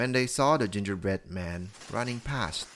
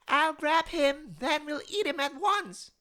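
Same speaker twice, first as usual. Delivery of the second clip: put-on old woman shouting. A narrator's reading. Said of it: "shouted the old woman".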